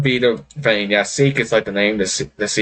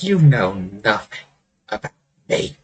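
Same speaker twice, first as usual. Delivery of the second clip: slow, cold retort fading to a whisper; glaring. Acted. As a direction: draw it out, then drop away to a near-whisper. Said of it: "She gives you a glare".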